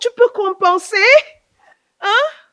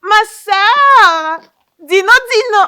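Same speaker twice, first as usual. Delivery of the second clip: loud high call across the house; 6 semitones higher, 16 dB louder, much slower than usual. Acted. Aunt calling her big sister from another room, loud.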